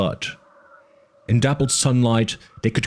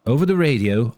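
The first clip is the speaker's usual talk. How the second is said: ordinary delivery, nothing marked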